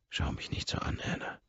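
breathy whisper, voice dropped right down; hushed, longing whisper; whispered plea. Acted. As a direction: whisper it close to her ear. Intimate plea to Anna.

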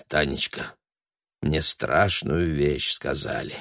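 low, slow, grave undertone; hushed. A narrator's reading. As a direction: confide dreadful news quietly. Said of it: "проговорил он негромко".

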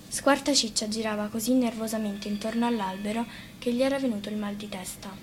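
plain reading voice

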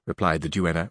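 plain reading voice

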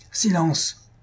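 high-pitched command, not truly shouted; mild narrated cry of command; restrained cry. A narrator's reading. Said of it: "cria le Purohita".

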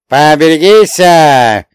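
loud, slow, drawn-out shout; shouted warning. A narrator's reading. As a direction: bellow a warning from the sleigh. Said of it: "выкрикнул Ломонос".

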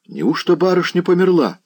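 high-pitched stunned question; shocked disbelief. A narrator's reading. Servant hearing the young lady is dead, high shocked disbelief. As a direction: ask it aghast, voice jumping up.

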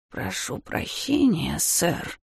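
put-on slow, faint whisper of an old beggar; old woman whispering. A narrator's reading. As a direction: barely breathe it like a frail old woman. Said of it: "еле слышно прошептала старушка".

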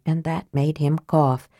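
ordinary delivery

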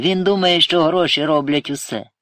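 firm, loud correction. A narrator's reading. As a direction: raise it and insist, without tears. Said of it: "твердо мовив Сергій".

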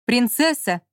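muffled shout from behind a door; calling through a door. Acted. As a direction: call out from beyond the door. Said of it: "раздался за дверью мужской голос".

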